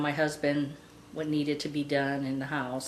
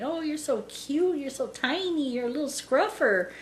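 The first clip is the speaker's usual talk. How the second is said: high cooing, imitating someone fussing over a puppy; retold high-voiced puppy adoration; retold doting pet talk; 7 semitones higher than usual. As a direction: mimic gushing over a tiny dog, pitched up. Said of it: "he started, you know, saying".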